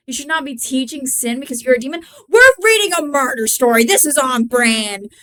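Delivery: plain reading voice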